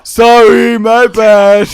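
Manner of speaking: loud apology through a laugh. Real, not acted. Player apologising to a teammate, loud and laughing. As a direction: call it out loudly, amused.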